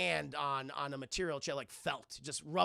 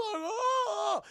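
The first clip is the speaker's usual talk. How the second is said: startled shout; 5 dB louder than usual. Retold or imitated. Man startled by ghost.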